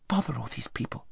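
whispered aside to herself; whispered grumble; hushed private thought. A narrator's reading.

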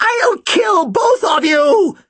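very high, long screamed threat; thin inhuman wail. A narrator's reading. Killer threatening both brothers in darkness, screaming. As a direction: wail it in a crazed shriek. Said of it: "Her voice was a thin, inhuman wail".

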